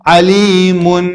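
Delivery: عەلیمون drawn out, ending on a sounded n; audible tanween n. Shown as a demonstration. Teacher checking students hear the nun, عەلیمون with clear final n. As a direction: let the final n of عەلیمون ring out. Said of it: "نونتان بیست یان نەتان بیست؟".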